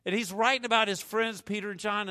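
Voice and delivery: ordinary talk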